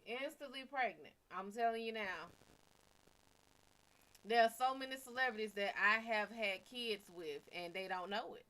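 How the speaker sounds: usual speaking voice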